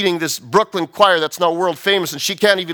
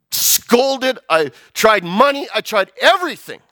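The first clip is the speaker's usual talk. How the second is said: higher, emphatic list of failed efforts; acted desperation; 6 semitones higher, slower than usual. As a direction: build it up like a desperate father. Father's desperate efforts retold.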